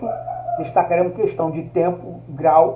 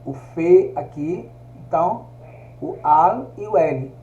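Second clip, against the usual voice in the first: slowly pointing out each piece, ni, fe, al; slow, careful breakdown for students; slow spelling out; slower than usual. Shown as a demonstration. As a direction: spell the word out part by part, unhurried. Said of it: "levou o ni aqui".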